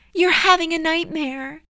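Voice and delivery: high, tender motherly voice; gentle high reassurance; sweet, soothing mother. Acted. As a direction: soothe a child out of a bad dream, pitched high. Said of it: "Her voice was sweet and soothing".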